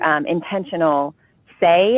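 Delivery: normal talking voice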